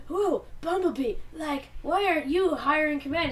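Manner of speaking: lightly voiced eager young robot; Smokescreen voice. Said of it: "The conversation goes like".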